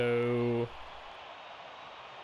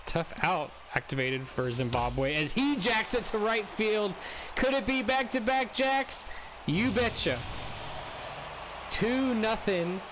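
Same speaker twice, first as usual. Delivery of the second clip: live play-by-play; 6 semitones higher than usual. Acted. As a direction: call the hit like a broadcaster.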